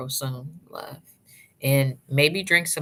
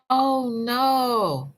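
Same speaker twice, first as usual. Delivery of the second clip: dismayed oh no; 8 semitones higher than usual. Real, not acted. Reacting to a viewer's bad news.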